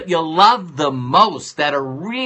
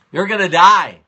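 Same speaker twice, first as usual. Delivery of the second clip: blunt doctor verdict; 3 semitones higher, 7 dB louder than usual. Retold or imitated.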